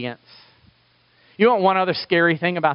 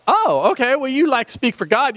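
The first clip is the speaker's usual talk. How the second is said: casually impressed; 8 semitones higher than usual. Retold or imitated. Acting out Danites reacting to Levite.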